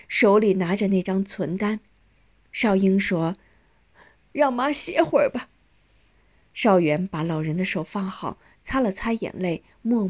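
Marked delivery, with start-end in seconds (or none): 4.3-5.5 high, pained plea; tearful request. A narrator's reading. Daughter asking that exhausted mother rest, sorrowful. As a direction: ask sadly, voice lifted.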